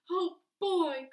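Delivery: put-on high, loud boyish cry; thrilled boy crying out; excited cry. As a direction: cry out with delight. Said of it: "cries Howard".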